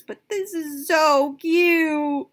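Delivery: high, loud, slow gushing; gushing squeal. Real, not acted. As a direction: squeal it with delight.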